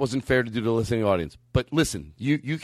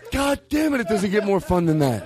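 ordinary talk, nothing marked

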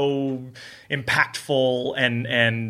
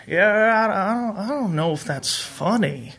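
higher-pitched mock hesitation, unconvinced; skeptical; 7 semitones higher than usual. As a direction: imitate a doubtful, reluctant host. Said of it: "Woody was not, uh, loving the quality".

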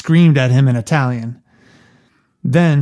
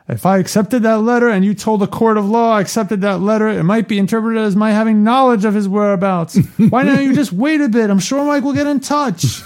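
high, careful lawyer voice; a higher, overly careful legal hedging; Hagen's lawyerly voice; 8 semitones higher than usual. Retold or imitated. Hagen's legal phrasing acted out.

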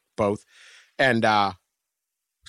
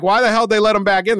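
much higher, louder disbelieving outburst; incredulous yell; 12 semitones higher, 8 dB louder than usual. Retold or imitated. Reacting to fighters let back into bar, loud disbelief. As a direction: blurt it out in disbelief. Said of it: "So I'm like".

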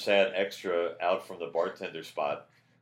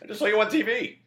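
high, loud, excited quoted relative; 8 dB louder than usual. Retold or imitated. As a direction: squeal it like an excited aunt. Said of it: "especially relatives".

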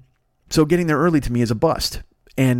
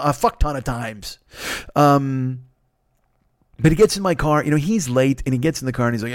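ordinary talk, nothing marked

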